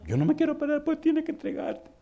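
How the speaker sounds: put-on high, childish back-and-forth; mock mother and daughter bickering; voiced quarrel. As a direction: play both sides in a high voice.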